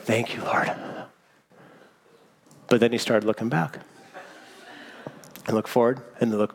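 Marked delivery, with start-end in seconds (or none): 0.0-0.7 breathy whispered thanks, much softer; whispered relief. Retold or imitated. Preacher whispering relief as the man sits down. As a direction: whisper it like a grateful prayer. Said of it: "I was like".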